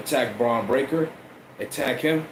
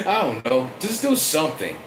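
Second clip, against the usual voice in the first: ordinary talk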